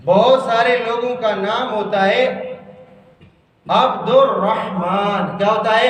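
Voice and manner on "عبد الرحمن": عبد الرحمن slowly, "abdor-RAH-maan"; correct pronunciation. Shown as a demonstration. Teacher modelling the name for class, correct pronunciation. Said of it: "زبر ہے، رحمان نہیں ہے".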